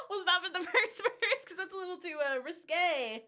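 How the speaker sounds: laughing through the words; amused, breaking into laughs; real embarrassed laughter. Real, not acted. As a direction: giggle while calling a halt to it. Laughs at raunchy lyrics, stops.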